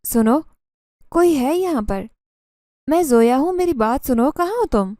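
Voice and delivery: calling loudly into the empty haveli, rising high. Acted. Zoya calls out to the hidden jinn, loud and high. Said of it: "اسے آواز دینے لگی".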